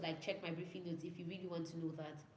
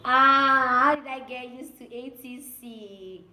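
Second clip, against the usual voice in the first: loud, high, slow teaser question; dramatic opener; 4 semitones higher, 15 dB louder, much slower than usual. Acted. Vlogger teasing his followers with a dramatic question. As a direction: hook the viewers with a drawn-out question.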